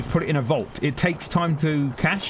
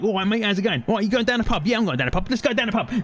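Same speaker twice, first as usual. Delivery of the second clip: put-on quick, higher cheery London accent; London geezer voice, pitched up and lively; 7 semitones higher, faster than usual. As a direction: chat like a London mate off to the pub. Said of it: "most of what my current accent is".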